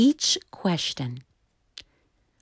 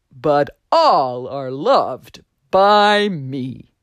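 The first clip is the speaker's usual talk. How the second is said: slowing, tender reassurance; loving creator; 2 semitones higher, 5 dB louder than usual. Acted. Voice on the wind telling all trees they are loved, tender and slow. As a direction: savor every word like a loving maker.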